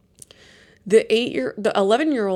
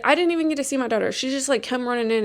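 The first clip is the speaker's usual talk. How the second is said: usual speaking voice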